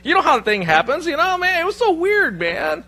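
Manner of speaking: high, loud, hammed-up innocence; mock-bewildered protest. Aaron feigning amazement to brother Moses, mock-bewildered protest. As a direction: protest wildly that it's a total mystery.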